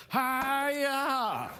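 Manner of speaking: high sharp karate shout; karate yell. Acted. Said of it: "check this out".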